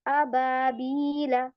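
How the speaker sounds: drawn-out Quranic recitation of अबाबीला; slow recitation. Shown as a demonstration. Teacher reciting slowly for students, drawn out. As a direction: stretch each syllable of अबाबीला slowly. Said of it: "Hamza से शुरू है".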